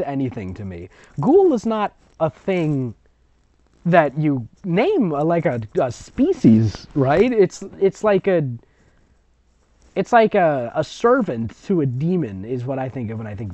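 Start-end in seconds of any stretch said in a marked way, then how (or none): none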